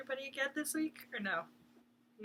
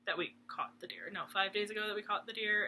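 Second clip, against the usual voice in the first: normal talking voice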